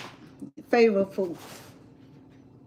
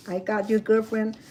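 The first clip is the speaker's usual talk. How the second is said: ordinary talk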